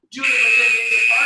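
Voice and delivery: sudden loud shout. Real, not acted. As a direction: shout it across the gym. Coach shouting mid-workout.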